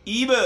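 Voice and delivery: ایب ending with an echoing bounce on the ب; qalqalah on ba. Shown as a demonstration. Teacher shows the qalqalah on the ب, short echo. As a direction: bounce the final ب. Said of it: "اس میں قلقلہ".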